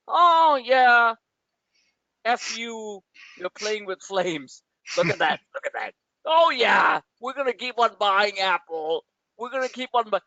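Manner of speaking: lightly mock the gung-ho traders. Analyst mimicking traders still buying Apple, mock hype. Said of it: "This is what the traders are right now, saying".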